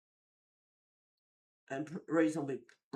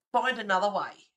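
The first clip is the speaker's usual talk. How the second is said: raised-voice brush-off, higher pitched; loud retold retort; 4 semitones higher, 8 dB louder than usual. Streamer retelling brushing off a woman pushing through, loud retort. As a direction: loudly send them packing.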